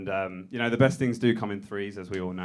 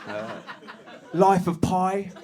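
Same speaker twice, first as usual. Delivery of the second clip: higher, louder, slow laid-back writers' room lead voice; pretend sketch writer; 5 semitones higher, 5 dB louder, slower than usual. Tommy's partner reading the week's insult list, higher and louder. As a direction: play a slick boss listing the week's insults. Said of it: "acted writers' room boss".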